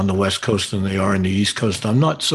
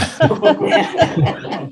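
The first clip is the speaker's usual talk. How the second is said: embarrassed laugh. Real, not acted. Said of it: "can I say the word lust".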